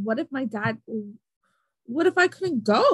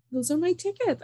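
higher, pressing claim; insistent; 8 semitones higher than usual. Retold or imitated. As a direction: stand your ground, pitch up. Insisting to ticket agent.

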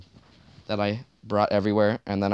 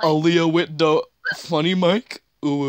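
slow, high, louder mock announcer tease; teasing; 7 semitones higher, 7 dB louder than usual. Host teasing guest about funny mic, mock announcer. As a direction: ham up the jab at her mic.

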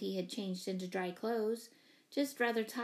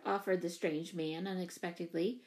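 plain reading voice

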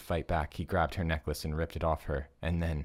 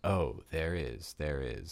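savoring every word; slower than usual. Acted. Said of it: "savoring every word".

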